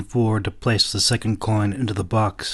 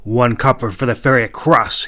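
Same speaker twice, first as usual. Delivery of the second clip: firm declaration; 2 semitones higher, 7 dB louder than usual. A narrator's reading. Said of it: "he declared in a firm voice".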